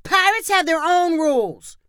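put-on high-pitched defiant child's yell; kid's yell. A narrator's reading. Pirate kid answering the parent back, high yell. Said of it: "one of the kids yelled".

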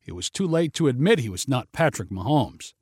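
usual speaking voice

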